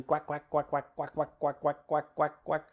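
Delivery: rapid, even run of imitated quacks; ducks quacking. Coach mimicking farm ducks for player, steady quacking. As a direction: quack on and on like a flock. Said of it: "those ducks are going".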